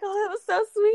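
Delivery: high, gushing "so sweet"; mock-sweet gush way up high; gushing quote. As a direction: coo it like you're touched. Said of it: "I'm like".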